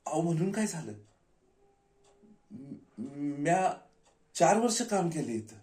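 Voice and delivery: high-pitched, stumbling objection; indignant protest. Acted. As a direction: plead your right to get in. Acted protest in gate quarrel.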